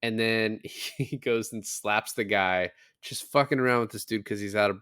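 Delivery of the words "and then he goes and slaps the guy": normal talking voice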